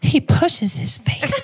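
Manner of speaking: high breathy woman voice, laughing; man doing a woman's voice, slow and high. Pretend. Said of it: "so I gotta go into a girl's voice here".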